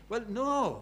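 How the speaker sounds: ordinary delivery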